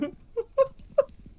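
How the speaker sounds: shrill relieved laugh. Real, not acted. Relieved after scare.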